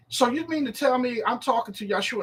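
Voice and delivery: ordinary delivery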